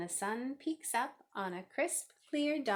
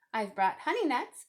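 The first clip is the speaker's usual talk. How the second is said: grinning badger; 4 semitones higher, 6 dB louder than usual. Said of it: "badger says with a grin".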